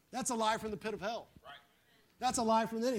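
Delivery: ordinary talk, nothing marked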